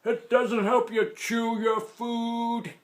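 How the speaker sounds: put-on high, slow old man complaint; grumpy grandpa. A narrator's reading. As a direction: drag it out like a toothless grandpa. Grandpa complaining about teeth.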